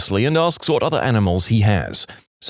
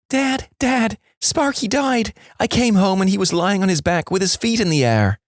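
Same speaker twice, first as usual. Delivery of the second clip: put-on tearful child; 9 semitones higher than usual. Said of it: "comes running in, in tears".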